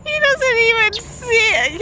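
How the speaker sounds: real laughter breaking through the words; helpless giggling fit, barely getting words out; laughing hard. Real, not acted. Owner at the park laughing at a bird attacking the dog, laughing hard.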